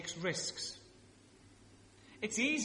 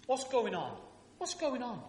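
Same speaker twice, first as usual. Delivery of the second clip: raised, louder London soap voice; London accent; 7 semitones higher, 4 dB louder than usual. Acted. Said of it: "I'll do the accent".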